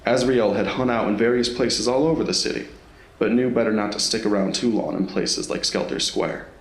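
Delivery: usual speaking voice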